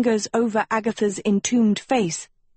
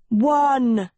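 higher, drawn-out call; shouted count; 5 semitones higher, 4 dB louder than usual. A narrator's reading. Tedros counting down to break in, calling out.

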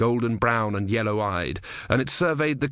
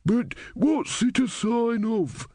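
put-on much higher, slow quavery old man; high, slow elderly man puzzled; 12 semitones higher, much slower than usual. A narrator's reading. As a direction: stretch it out like a doddering old man. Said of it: "said an elderly man".

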